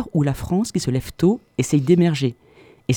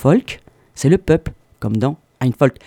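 ordinary talk

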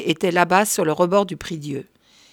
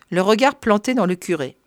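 ordinary delivery, nothing marked

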